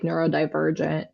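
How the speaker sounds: normal talking voice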